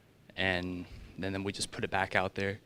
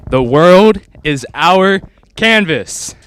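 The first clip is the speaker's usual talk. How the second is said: slow, big loud proclamation; proclaiming loudly; 8 semitones higher, 23 dB louder, much slower than usual. Real, not acted. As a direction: announce it like a slogan. Student delivers tagline on the spot.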